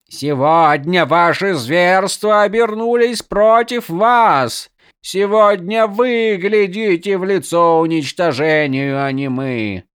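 defiant cry behind a visor; echoing voice inside helmet. Acted. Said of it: "раздался её голос из-под шлема".

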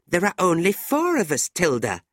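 high-pitched, insistent reply; pointed young woman. A narrator's reading. Fanny eyeing Nicholas while answering Tilda, pointed.

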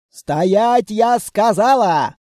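slow, emphatic angry order; angry command. A narrator's reading. Enraged grandmother blocks the runaways' path, angry command. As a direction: stretch out a furious command. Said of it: "Разгневанная бабушка".

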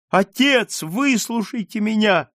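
very high, desperate plea; crying out. A narrator's reading. Margarita begging her father to listen, crying out. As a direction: beg to be heard on a high cry. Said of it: "кричала Маргарита".

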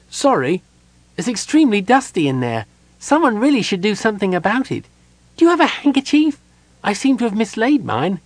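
high, quick, rambling kender chatter. A narrator's reading. Kender rambling to Gerard, high and chatty. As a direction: babble on brightly and innocently.

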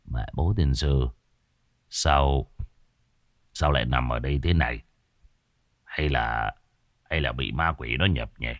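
low, soft, confused muttering; mumbling to himself. Acted. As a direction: grumble under the breath, dazed. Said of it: "Ông lẩm bẩm".

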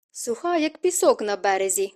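ordinary delivery

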